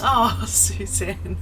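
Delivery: usual speaking voice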